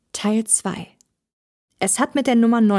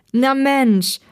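pitched up and louder, pleased greeting; delighted exclamation; 5 semitones higher, 4 dB louder than usual. A narrator's reading. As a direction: call out in happy surprise. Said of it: "rief Thomas erfreut aus".

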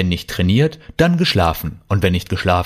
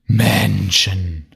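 drawn-out weary sigh of a word. Acted. Kalle, woken from hibernation, complains of humans, sighing. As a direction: sigh it out in mild exasperation. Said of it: "seufzte er".

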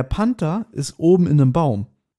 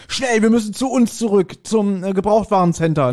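urgent Justus; 5 semitones higher than usual. Acted. Acting Justus's sudden alarm.

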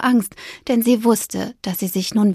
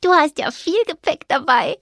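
lightly teasing mouse; 7 semitones higher than usual. A narrator's reading. Fipper teasing.

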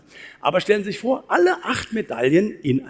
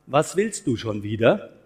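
"willst" lifted, slow, lower overall; stress on willst; 5 semitones lower, slower than usual. Shown as a demonstration. Speaker shows the audience a new meaning by stressing "willst". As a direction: lean on "willst" to sound annoyed at the wish. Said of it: "Man könnte aber auch sagen".

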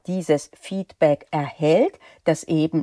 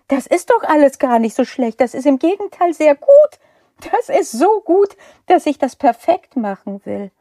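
fast, higher and louder, insisting it is good; eager protest; 7 semitones higher, 5 dB louder, faster than usual. Retold or imitated. Supervisor insisting to the student that the work is good, eager. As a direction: rush to reassure, a bit hammed up. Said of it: "ganz entsetzt".

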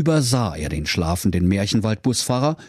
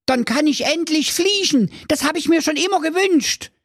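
high-pitched naive joy; foolish Thorsten, delighted. Acted. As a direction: welcome the threat as a wish come true. Thorsten rejoices at flying. (hohe, begeisterte Stimme des törichten Thorsten)

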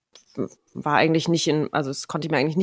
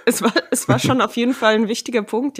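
lachend gesprochen; 5 semitones higher, 5 dB louder than usual. Real, not acted. Lacht über Zuganbindungsfrage.

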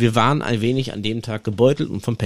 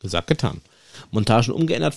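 normal talking voice

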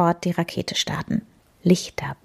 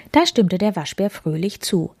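ordinary delivery

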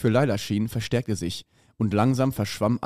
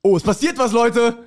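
high, bright outburst of glee; excited; 10 semitones higher, 4 dB louder than usual. Real, not acted. Reader hyping up his listeners, excited. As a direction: burst out that something is happening.

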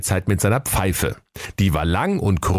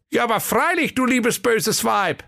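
silly conductor being polite to the witch; polite fool. Acted. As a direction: answer sweetly like a good boy. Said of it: "sagte der Schwerthelm artig".

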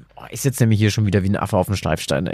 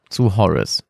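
usual speaking voice

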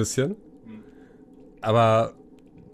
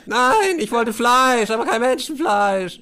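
high, loud protest easing into slower insistence; indignant prisoner; 12 semitones higher, 5 dB louder, slower than usual. Acted. Prisoner clarifying the meat request, indignant. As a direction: set the cellmate straight, offended.

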